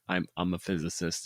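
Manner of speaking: ordinary talk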